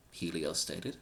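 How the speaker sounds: plain reading voice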